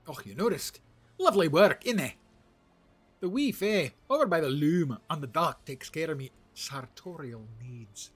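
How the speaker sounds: loud, high hammed-up Scottish brogue, easing off at the end; over-the-top fake Scottish accent; bad Scottish accent. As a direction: play a proud Scottish dwarf, badly. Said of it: "That was my bad Scottish accent".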